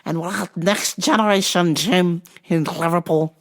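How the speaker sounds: high, excitable bad Scouse; squeaky amateur Liverpool accent; terrible Liverpool accent. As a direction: pitch it up into a hammed-up Scouse voice. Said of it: "terrible accent".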